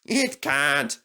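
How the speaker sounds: high, insistent contradiction; stubborn denial. Acted. Sir Handel arguing with Rheneas, high stubborn denial. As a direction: dig in and refuse to believe it. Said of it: "A noisy argument started".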